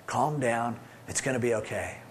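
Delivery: soft, low and calming; God quieting his frightened sheep, softly; soothing hush. Acted. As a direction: settle them down gently and quietly. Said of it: "He goes".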